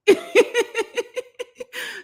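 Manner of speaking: a bright, high burst of laughter. Real, not acted. Laughs after own quote.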